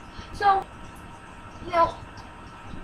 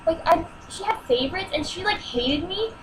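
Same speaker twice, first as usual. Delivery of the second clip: ordinary talk, nothing marked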